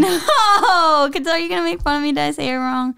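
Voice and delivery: high wailed no, then quick complaint; whining protest. Real, not acted. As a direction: protest in a laughing whine. Refusing to repeat, fears mockery.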